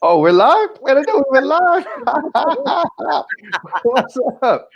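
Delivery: high surprised giggle; surprised laughing. Real, not acted. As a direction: caught off guard, laughing.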